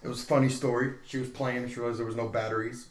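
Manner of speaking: ordinary talk, nothing marked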